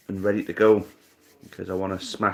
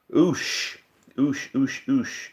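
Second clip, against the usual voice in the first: high oosh; 6 semitones higher, much slower than usual. Real, not acted. Reacting to tough clue.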